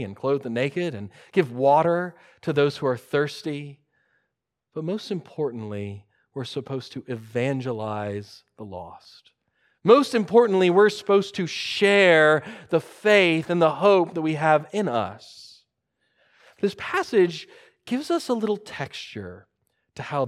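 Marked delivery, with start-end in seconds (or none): 4.7-9.3 soft, low statement of a key point; understated emphasis. Real, not acted. Key point stated softly, then repeated. 9.8-15.6 louder, raised restatement of a key point; emphatic preaching. Real, not acted. Same point repeated with force.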